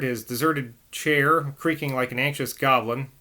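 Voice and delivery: usual speaking voice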